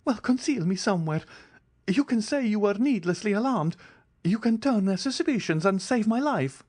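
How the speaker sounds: high, urgent coaxing plea; desperate man bargaining for his life; pleading fugitive. A narrator's reading. Andrea begging to hide.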